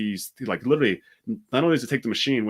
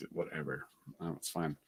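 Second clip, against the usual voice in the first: much softer, dismissive shrug of a line; quiet brush-off; 6 semitones lower, 15 dB quieter than usual. Retold or imitated. Shrugging off the kid's threats, soft and dismissive. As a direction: let it drop, barely voiced.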